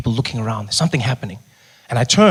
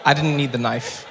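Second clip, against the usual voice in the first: normal talking voice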